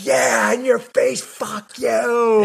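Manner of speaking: high, drawn-out triumphant taunt; in-your-face gloating. Retold or imitated. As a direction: crow slowly and gleefully at a rival. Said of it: "I just got into his face".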